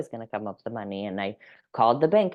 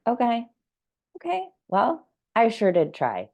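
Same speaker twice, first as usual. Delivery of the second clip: accepting shrug; 8 semitones higher than usual. Pretend. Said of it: "But I'm like".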